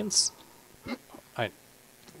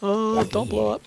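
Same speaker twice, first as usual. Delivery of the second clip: high, slow worried plea; 12 semitones higher, slower than usual. Real, not acted. Gamer begging a creeper not to explode, high slow worried plea.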